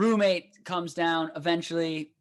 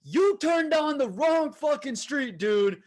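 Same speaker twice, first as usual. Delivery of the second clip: brother confronting; 9 semitones higher than usual. Retold or imitated. Older brother yelling back at the truck drivers, high mock anger. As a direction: play the angry older brother. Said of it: "he's like".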